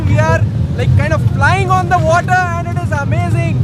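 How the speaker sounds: pushing words over wind noise on a boat; wind on mic. Real, not acted. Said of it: "forgive me for the wind noise".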